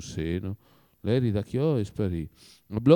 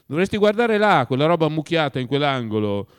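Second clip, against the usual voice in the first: higher and louder, bossy command; husband pointing out the mess, imperious and louder; 5 semitones higher, 5 dB louder than usual. Acted. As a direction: push the order harder, domineering. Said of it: "ringhiava Zahar in tono imperioso".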